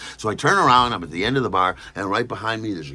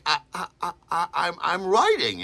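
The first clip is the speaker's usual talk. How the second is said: higher, stuttering in fright; stammering terrified; 9 semitones higher than usual. Retold or imitated. Writer stammering to the angry bartender. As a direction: stammer it out scared. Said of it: "I'm terrified".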